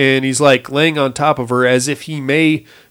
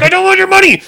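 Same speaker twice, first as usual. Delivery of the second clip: much higher pleading voice; dad insisting to the lady, higher voice; frantic dad; 4 dB louder, faster than usual. Retold or imitated. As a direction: plead it up high.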